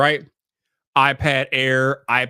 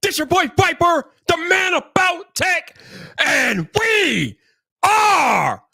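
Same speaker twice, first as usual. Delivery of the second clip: high, shouted announcer intro with stretched-out words; hype show opening; 14 semitones higher, much slower than usual. Acted. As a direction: belt out a live show welcome with long whoops. Performs the show's opening.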